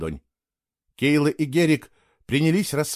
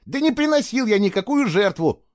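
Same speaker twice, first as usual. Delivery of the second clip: high, indignant protest; heated denial; 10 semitones higher, 3 dB louder than usual. A narrator's reading. Boy denying he made a sacrifice, heated. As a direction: deny it hotly.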